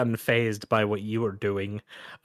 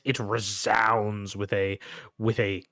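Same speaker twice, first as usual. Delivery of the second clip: ordinary talk, nothing marked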